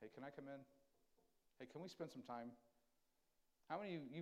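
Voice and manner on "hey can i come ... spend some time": soft, tender invitation; God knocking gently at the heart, soft and tender; gentle God's voice. Acted. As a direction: coax softly through the door. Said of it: "so ever lovingly".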